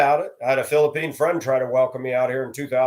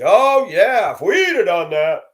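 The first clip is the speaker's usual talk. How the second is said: higher, louder bitter sarcasm; sarcastic scoff; 8 semitones higher, 7 dB louder, slower than usual. Retold or imitated. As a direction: drip with irony. Sarcastic remark on privilege.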